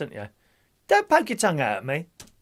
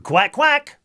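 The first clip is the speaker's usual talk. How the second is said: high, loud quacking; duck quacks; 11 semitones higher, 7 dB louder than usual. Acted. Two little ducks call.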